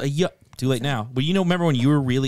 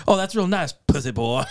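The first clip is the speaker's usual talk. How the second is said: mock praise from a beaten rival; hammed-up gamer jeer, starting high; imitated sarcastic taunt; 7 semitones higher than usual. Killed player taunting his killer over game comms, imitated jeering. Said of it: "there's those death comms, he's like".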